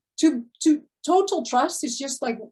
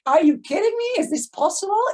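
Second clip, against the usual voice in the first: higher and louder, gushing disbelief; amazed excitement; 8 semitones higher, 5 dB louder than usual. Retold or imitated. The right people reacting to her mastery, excited and amazed. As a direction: play the thrilled client who can't believe it. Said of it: "They're like".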